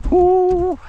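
real loud high whoop. Real, not acted. Angler celebrating a good trout, loud whoop. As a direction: let out a big celebratory woo. Fish in the net.

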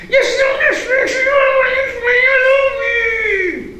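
very high, loud and unclear monster wail; hissing octopus. A narrator's reading. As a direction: hiss it out so it's hard to make out. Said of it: "odsyczała ośmiornica, ale tak niewyraźnie".

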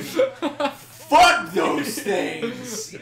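mock rage. Real, not acted. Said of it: "awoken a deep and seeping rage".

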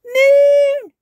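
put-on very high, loud toddler shout; excited cry. A narrator's reading. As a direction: shout it with delight. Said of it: "cries George".

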